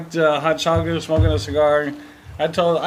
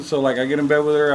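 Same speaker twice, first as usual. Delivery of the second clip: ordinary delivery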